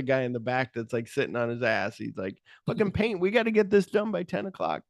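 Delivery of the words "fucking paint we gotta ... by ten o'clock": high, griping boss barking about deadline; Dick Miller. Retold or imitated. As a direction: grouse about the paint job. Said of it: "Dick Miller".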